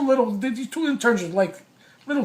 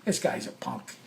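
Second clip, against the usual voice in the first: soft, dismissive retold thought; muttering to himself; 6 semitones lower, 10 dB quieter than usual. Storyteller reacts to the demand for writing, muttering to himself. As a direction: drop it under your breath as a private aside. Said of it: "I said to myself".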